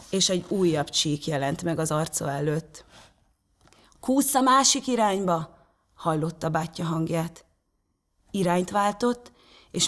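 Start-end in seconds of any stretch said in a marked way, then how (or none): 4.0-5.6 higher, urgent call to brother; called-out instruction. A narrator's reading. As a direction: direct him from a distance. Brother calls directions across ice.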